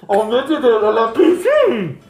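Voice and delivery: fuzzy tape voice. Retold or imitated. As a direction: sound like a crackly old cassette. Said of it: "and it'd be like".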